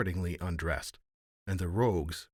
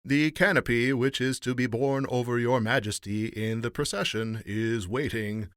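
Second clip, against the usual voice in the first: loud, raised, level herald's call; formal announcement; 4 semitones higher, 6 dB louder than usual. A narrator's reading. Master of ceremonies to the emperor, announcing. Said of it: "announced the chief master of the ceremonies".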